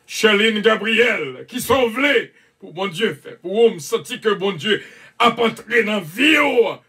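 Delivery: rising to a shout of praise; fervent preaching. Acted.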